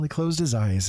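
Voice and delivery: usual speaking voice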